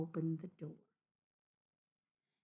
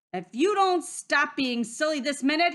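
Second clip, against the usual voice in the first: loud, high-raised wolf threat; scolding full-voiced roar; 7 semitones higher, 9 dB louder than usual. A narrator's reading. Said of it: "he roared".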